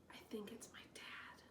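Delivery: breathy unvoiced whisper. A narrator's reading. Tobin whispers to the narrator, awed whisper. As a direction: hush it to your friend.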